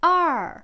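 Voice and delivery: "Ar" loud, falling steeply from high; fourth tone. Shown as a demonstration. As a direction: drop "Ar" sharply from a high start. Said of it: "quatrième ton qui descend".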